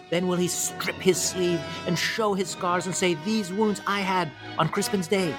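stirring king's speech to his soldiers; amateur Shakespeare recital. Acted. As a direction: declaim it grandly, lifting key phrases high. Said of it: "presented to you by me in my".

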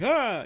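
a high, loud, startled ah. Retold or imitated. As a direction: yelp it like a horror jump scare. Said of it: "I was like".

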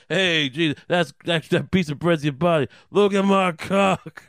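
loud, brash comic heckle; Judas Dice Clay. Acted. Judas interrupts Jesus at the Last Supper, brash mock Dice shout. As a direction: badly imitate Andrew Dice Clay, stretching the last words loud. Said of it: "Judas Dice Clay comes out and goes".

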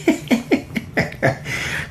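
high, airless laugh; breathless voiceless giggle; silent laugh. Real, not acted. Host laughing at the memory, silent laugh.

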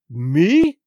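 a loud, indignant question; acted affronted captain; incredulous retort. A narrator's reading. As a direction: throw it back in disbelief.

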